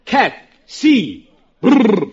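teeth chattering, brr between letters; spelling it out while freezing; shivering with cold. Acted. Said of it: "They're having a cold spell".

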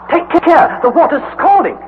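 high, loud cry of alarm. Acted. Harry warning his uncle about scalding water, crying out high. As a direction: yelp out the warning as your hand burns. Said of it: "Involuntarily, I cried".

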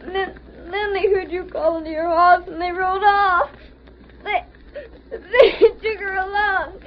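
put-on sobbing boy, stumbling over words; crying between phrases; tearful account. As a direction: tell it haltingly through tears. Crying boy tells of kidnapping.

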